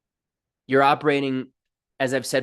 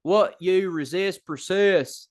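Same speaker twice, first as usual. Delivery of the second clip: slow, higher-pitched Southern drawl; hammed-up Southern twang; Southern accent; 6 semitones higher, slower than usual. Shown as a demonstration. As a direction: stretch the vowels in a playful Southern accent.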